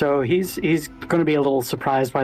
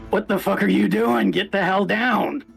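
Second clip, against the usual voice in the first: high, raspy ghoul yelling at a friend; 7 semitones higher than usual. Acted. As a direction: bark it out like an angry ghoul. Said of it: "I need to get my ghoul voice going".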